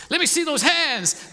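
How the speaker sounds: loud, high, drawn-out preacher's shout; Pentecostal preacher. Acted. Preacher calling the congregation to raise hands, hammed-up Pentecostal shout. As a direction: holler it out like a revival preacher. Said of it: "Oh, I'm getting Pentecostal".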